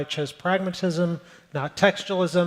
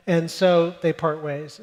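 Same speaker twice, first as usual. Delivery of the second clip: ordinary delivery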